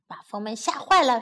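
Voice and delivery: usual speaking voice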